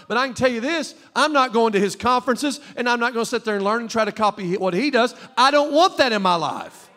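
high, insistent pulpit challenge; forceful preaching. Real, not acted. Preacher warning church about famous preachers, forceful preaching. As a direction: challenge the congregation hard.

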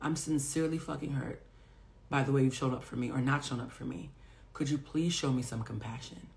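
soft, hurt plea to a friend; vulnerable plea. Retold or imitated. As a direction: say it quietly, wounded and sincere. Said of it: "be vulnerable and brave enough to say".

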